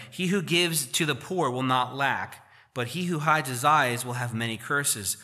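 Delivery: slow, deliberate reading of the verse; scripture reading. Acted. Pastor reading Proverbs to the church, slow and deliberate. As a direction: read it out slowly to the congregation.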